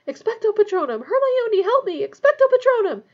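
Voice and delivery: high, loud cries for help; desperate shouting. A narrator's reading. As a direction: shout the spell in rising panic. Harry casting desperately, calling for help.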